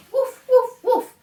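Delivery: loud, high playful woof; dog barks. Pretend. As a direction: bark like a puppy for the kids. Dog noise in animal song.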